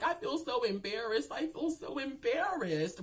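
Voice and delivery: high-pitched mock tearful whine; imitated crying. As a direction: play embarrassed and weepy, drawing it out. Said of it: "I'm crying and stuff".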